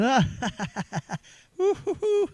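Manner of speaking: high, squealing hammed-up laugh; mocking laugh. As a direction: cackle at him like the smug doctor. Doctor laughs at broken leg.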